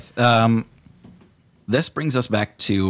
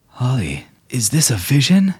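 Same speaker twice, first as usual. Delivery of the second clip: higher, louder, awestruck admirer; smitten fey suitor; 7 semitones higher, 4 dB louder than usual. Acted. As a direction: gush at her like a lovestruck nobleman.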